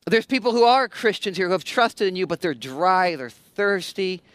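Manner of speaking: pastor praying over his church, hushed and sincere; prayer. Real, not acted. Leads a prayer.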